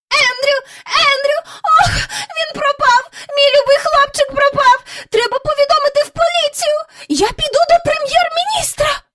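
put-on high, loud, frantic old lady calling for her dog; frantic crying out. A narrator's reading. Miss Lark crying out for her lost dog Andrew, high and frantic. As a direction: wail for the lost dog in a shrill panic. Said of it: "знай кричала".

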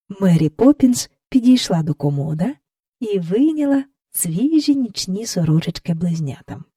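ordinary delivery

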